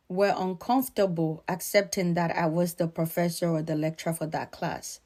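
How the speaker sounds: ordinary talk, nothing marked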